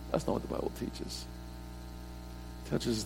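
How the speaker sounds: "That's not what the Bible teaches" whispered, soft and quick; hushed whispered echo of "That's not what the Bible teaches". Shown as a demonstration. Repeated line, second whispered.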